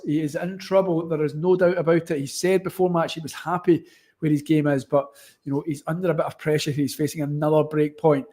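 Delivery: normal talking voice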